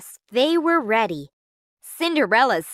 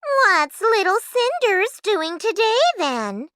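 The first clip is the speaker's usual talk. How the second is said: high, mocking stepsister voice; needling tease; teasing; 11 semitones higher than usual. A narrator's reading. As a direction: taunt in a raised sing-song pitch. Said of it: "teased Griselda".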